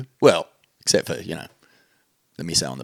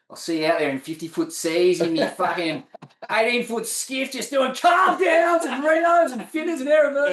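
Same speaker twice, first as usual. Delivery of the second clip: climbing into a high, strained shout; hammed-up hype; 12 semitones higher than usual. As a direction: build to a giddy high-pitched yell. Acting out boastful sailor.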